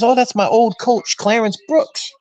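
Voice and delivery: a hint of a casual brother naming an old coach; brother answering casually. Brother voiced in retold story.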